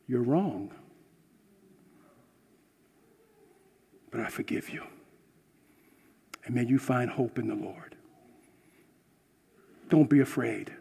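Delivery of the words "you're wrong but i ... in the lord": softer, hushed words of forgiveness; gentle whisper. Pretend. Mercy speaking forgiveness to the sinner, in a soft whisper. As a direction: breathe it out as tender mercy. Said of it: "Mercy says".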